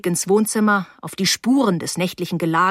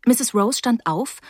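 ordinary delivery

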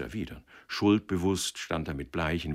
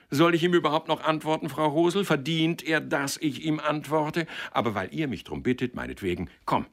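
high-pitched sarcastic tirade settling at the end; worked-up and contemptuous; scornful, indignant matchmaker; 10 semitones higher, 5 dB louder than usual. Acted. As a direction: ask in scorn, then grudgingly give in.

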